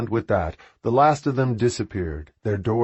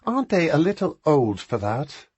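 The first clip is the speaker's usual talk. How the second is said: incredulous sister; 7 semitones higher than usual. A narrator's reading. Beatrice incredulous.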